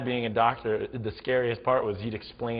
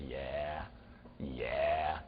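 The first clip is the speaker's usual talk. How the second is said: low, drawn-out "yeah"; gloating brother; 7 semitones lower, 8 dB quieter than usual. Retold or imitated. Brother walking away from the beating with his friend, gloating. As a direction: crow it low and slow, pleased with yourself.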